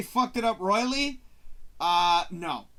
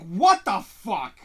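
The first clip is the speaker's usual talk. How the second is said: loud, slow disbelieving question; baffled; 8 dB louder, much slower than usual. Retold or imitated. Viewer confused by the sudden turn, loud slow bafflement. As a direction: stretch it out, lost.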